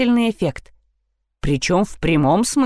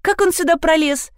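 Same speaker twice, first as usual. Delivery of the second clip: high, put-on astonishment; feigned surprise; 7 semitones higher than usual. A narrator's reading. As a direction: pretend you had no idea. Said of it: "попыталась изобразить удивление". (притворное удивление)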